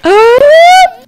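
sharp high gasp of shock; loud high gasp. Acted. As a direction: gasp as if catching someone red-handed. Shocked discovery in game.

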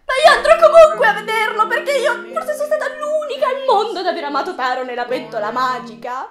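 high, fast comic shouting; hammed-up outburst. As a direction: blurt it out at full volume, rushing, nearly laughing. Mock outburst confessing her love.

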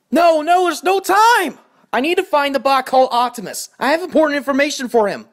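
desperate to get the message through; breathless high panic; frantic urgency. Acted. Messenger desperate to find Optimus.

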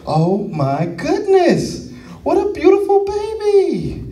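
slow, with a high gushing lift on the last words; gushing doctor. Retold or imitated. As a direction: gush over the baby, drawing it out. Doctor gushing over the baby.

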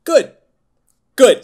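loud, high little cheer; gleeful. Real, not acted. Cheering the film's Razzie nomination to cohosts. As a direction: crow it out happily.

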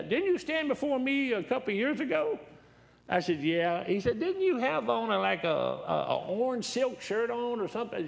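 high, loud questioning judge; judge's voice. Retold or imitated. Judge recalling his orange silk shirt, high loud voice. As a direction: play the judge with rising disbelief.

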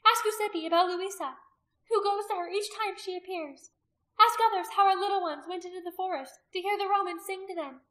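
high, loud, relentless incitement; zealous priestess. A narrator's reading. Priestess urging the people to condemn her, high and loud. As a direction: hammer the charges at the crowd.

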